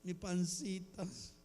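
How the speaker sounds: very soft, slow, affectionate whimper; mock tearful endearment. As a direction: whimper it fondly, hammed-up. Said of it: "le dicen cariñosamente al marido". (lloriqueo cariñoso fingido)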